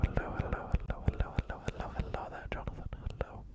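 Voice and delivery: normal talking voice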